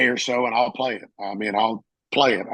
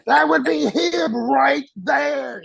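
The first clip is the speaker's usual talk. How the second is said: high, stretched-out shout; imitated screaming; 12 semitones higher, 3 dB louder, much slower than usual. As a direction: mimic a chief screaming, dragging out the words. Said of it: "just screaming".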